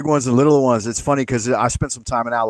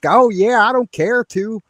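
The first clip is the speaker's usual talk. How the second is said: higher, sweet mock Southern girlfriend; mock Tennessee girlfriend; 8 semitones higher, slower than usual. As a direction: lift the voice, play her casual reply. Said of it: "she'd be like".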